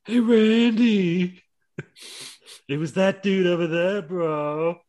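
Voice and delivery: high, long drawn-out bro voice; Riddle. Acted. Riddle on screen calling out to Randy, high dopey drawl. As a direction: stretch the call out high and slow. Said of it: "Riddle turns up because on the screen goes".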